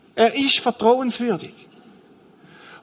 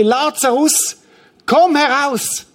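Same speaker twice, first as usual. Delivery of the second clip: higher-pitched, drawn-out summons; loud calling voice; 5 semitones higher, slower than usual. Acted. Jesus calling dead Lazarus out of the grave, loud call. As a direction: call out commandingly, stretching the final words. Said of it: "rief er mit lauter Stimme".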